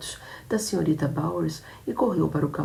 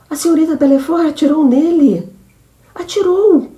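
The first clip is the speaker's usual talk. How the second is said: much higher and louder alarmed shout; panicked cry; 12 semitones higher, 11 dB louder than usual. Acted. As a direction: blurt out the shock. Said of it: "disse Cornélia". (grito de pânico, muito agudo)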